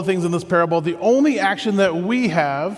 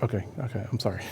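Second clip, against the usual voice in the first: contrite; 8 semitones lower, 7 dB quieter than usual. Retold or imitated. Humbled apology to Jesus after outburst.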